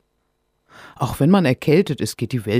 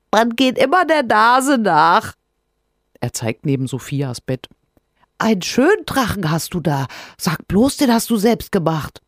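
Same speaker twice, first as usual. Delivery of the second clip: cheeky little creature; 8 semitones higher, 4 dB louder than usual. Acted. Rinus voice.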